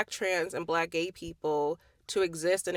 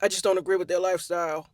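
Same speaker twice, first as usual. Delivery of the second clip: lightly put-on smug homophobe voice; mocking a bigot; 3 semitones lower, faster than usual. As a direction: parrot the prim disapproval with scorn. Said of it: "when people be like".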